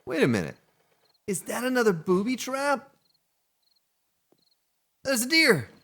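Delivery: high, loud excited squeal; suddenly thrilled at the sight; a sharp, high yelp of surprise. Real, not acted. Spotting a deer while hunting.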